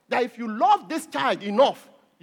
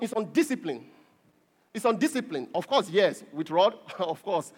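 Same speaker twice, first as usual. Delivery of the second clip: normal talking voice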